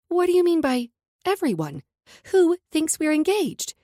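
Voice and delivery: usual speaking voice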